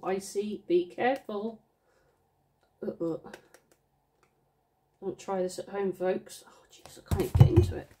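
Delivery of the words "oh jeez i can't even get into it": whisper. Real, not acted. Struggling with wrapping.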